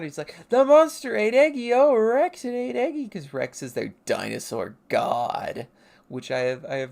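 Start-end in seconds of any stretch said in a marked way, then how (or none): 0.5-3.1 high, loud mock panic; freaking out. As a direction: shriek it like a panicking kid character. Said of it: "Tay's freaking out".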